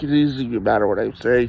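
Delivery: normal talking voice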